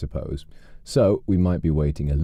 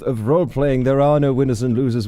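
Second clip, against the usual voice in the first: higher, stammered backpedalling disclaimer; mock disclaimer; 8 semitones higher than usual. Streamer reassuring viewers nobody loses in role-playing, mock disclaimer. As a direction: stammer into a prim caveat. Hasty disclaimer after the rally speech.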